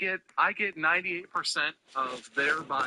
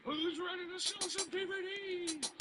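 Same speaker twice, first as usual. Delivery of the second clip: high, goofy hype-man shout; silly clown; 7 dB quieter than usual. Acted. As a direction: pump up the crowd like a cartoon goose. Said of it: "just like".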